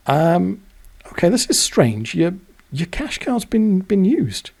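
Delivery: puzzled bank clerk. Retold or imitated.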